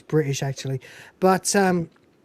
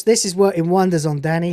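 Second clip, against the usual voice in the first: ordinary delivery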